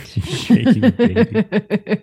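repeating it through real laughter; laughing. Real, not acted. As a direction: say it while cracking up. GM laughing at player's line.

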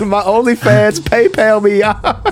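put-on Southern accent. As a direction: join in with a mock Southern twang. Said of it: "saying PayPal with that accent".

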